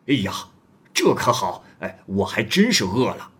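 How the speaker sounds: a hint of a rough wanderer asking; bold stranger.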